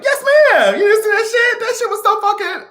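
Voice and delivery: high, loud, laughing exclamation; excited laughing. Real, not acted. Answering a viewer, loud, high and laughing.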